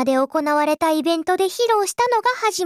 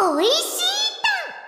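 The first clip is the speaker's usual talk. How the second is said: cute gushing; 12 semitones higher, faster than usual. Acted. Cute tasting catchphrase.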